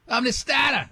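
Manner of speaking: high mock New England dropped r's; hammed-up New England imitation; New England accent. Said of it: "I never heard an accent so insane".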